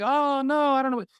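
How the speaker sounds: high, hammed-up wail of panic; mock crying freak-out. Doctor facing a patient's sickness, mock tearful panic. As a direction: imitate a doctor falling apart. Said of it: "freaking out and crying and like".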